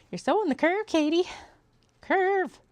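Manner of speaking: very high, loud squeal; mock self-scolding. Sewist scolding herself over the curve, high loud mock screech. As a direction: screech it at yourself, far above normal pitch.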